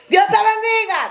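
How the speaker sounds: high, sustained mock yell; yelled blessing. Shown as a demonstration. Teacher shows parents a blessing is fine to yell, high yell. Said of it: "what are you yelling".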